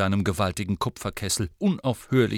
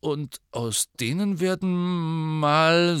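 put-on slow, higher boy voice; a touch anxious, drawn out; worried Ron; 6 semitones higher, slower than usual. A narrator's reading. Ron asking Hagrid about the maggots, slow and uneasy. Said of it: "mit besorgter Miene".

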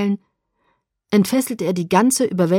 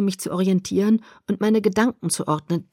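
usual speaking voice

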